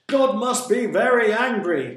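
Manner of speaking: very loud, high proclamation; crying out. A narrator's reading. Captain to his crew in the storm, crying out. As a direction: bellow it over a storm. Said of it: "cried the captain".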